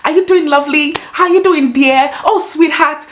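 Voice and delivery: high, lilting mock endearments; imitating over-familiar stranger. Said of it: "someone would just come and go".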